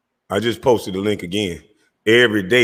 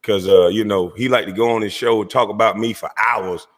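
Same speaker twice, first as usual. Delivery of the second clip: ordinary delivery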